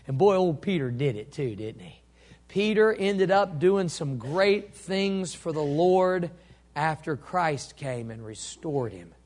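normal talking voice

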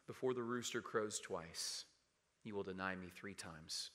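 low, subdued and hushed; somber recollection. Acted. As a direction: recall the warning softly, with regret. Peter recalls the warning, grieving.

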